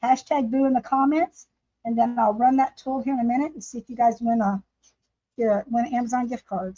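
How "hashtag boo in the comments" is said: ordinary delivery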